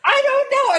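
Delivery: high, loud screamed denial; screaming. Retold or imitated. Teen girl screaming to her friend in the bathroom. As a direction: scream it, helpless and panicked. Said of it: "I was screaming".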